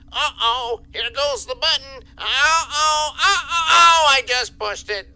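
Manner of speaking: hammed-up oops, growing to a wail; mock dismay. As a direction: pretend helpless horror at your own action. Boss gleefully pretends reluctance, pushing button.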